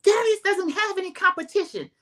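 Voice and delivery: high-pitched mock yell; retold yelling. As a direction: yell it as an imitation of a defensive fiancee. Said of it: "Jazzy is yelling".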